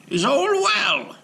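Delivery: high, loud, slow concerned rabbi; concerned call. Acted. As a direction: check on the motionless giant. Rabbi calls to golem.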